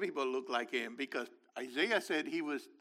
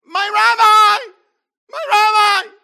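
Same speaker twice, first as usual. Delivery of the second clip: high, loud, slow shout of recognition; thrilled shouting cry; joyful exclamation; 20 dB louder, slower than usual. Shown as a demonstration. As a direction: cry it out like she has just recognized him.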